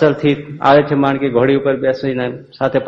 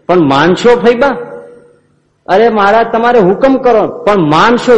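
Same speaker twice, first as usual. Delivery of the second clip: higher and louder pleading; rising insistence; 9 semitones higher, 5 dB louder than usual. Acted. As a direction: push the plea up each time. Pleading exchange rising in pitch.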